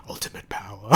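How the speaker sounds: unvoiced menacing whisper of "Ultimate power."; whispered villain line. Retelling the villain's answer to his Padawan, whispered. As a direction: breathe it like a scheming villain.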